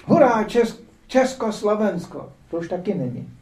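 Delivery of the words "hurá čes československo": high, loud cheer that slows; crowd cheering. Retold or imitated. Crowds cheering Czechoslovakia, high loud slow cheer. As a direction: cheer the new country. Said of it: "Pak tam křičeli".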